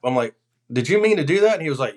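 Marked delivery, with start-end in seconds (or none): 0.7-1.5 higher, louder mock disbelief; incredulous. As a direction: ask it like you can't believe your eyes. Retold incredulous question to hunter.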